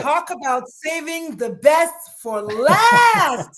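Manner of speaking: slow, loud build-up to the big reveal; excited announcement. Real, not acted. Guest drawing out her intro of the last pick, loud and slow. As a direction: drag it out and belt it with excitement.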